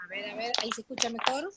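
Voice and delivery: quiet and distant on the line; phone held away. Real, not acted. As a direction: voice far from the microphone. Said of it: "Un poquito más".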